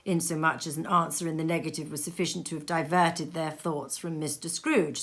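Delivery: ordinary delivery, nothing marked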